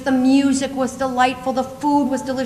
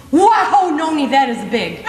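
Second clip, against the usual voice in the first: lightly voiced awestruck toddler, loud opening "wow"; amazed little boy; 3 dB louder, slower than usual. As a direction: imitate a small child's amazement, dropping after the first word. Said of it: "he turns over and he looks at my fanny and he goes".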